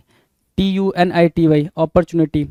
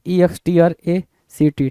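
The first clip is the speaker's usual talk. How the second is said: normal talking voice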